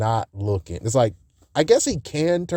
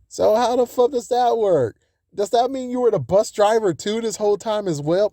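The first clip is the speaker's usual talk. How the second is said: higher, baffled questions; much higher incredulous voice; exasperated disbelief; 8 semitones higher than usual. Real, not acted. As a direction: ask it like it's absurd. Exasperated questions about bus driver.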